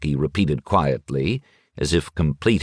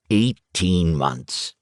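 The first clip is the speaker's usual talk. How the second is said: drawn-out, slow and deliberate; slow emphasis; much slower than usual. Acted. Schmidt slowly states how long they've been dead. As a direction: linger on each word of Eighteen months. Schmidt stresses the time, repeated.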